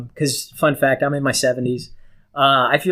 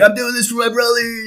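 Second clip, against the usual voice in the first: higher, noble, mock heroic vow; heroic Raph quote; 9 semitones higher than usual. Podcast friend voicing Raph's last words, mock heroic. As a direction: ham up a self-sacrifice line. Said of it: "Be like".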